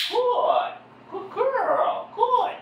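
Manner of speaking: high-pitched cheery praise; sing-song praise for a puppy; high happy praise. Real, not acted. Praising puppy after sit.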